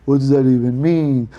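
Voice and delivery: slow, drawn-out mimicry of complainers; mock outrage. Owner imitates critics of the Sexy Walls name, mock-outraged. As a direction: parody a fussy objection, dissolving into blahs.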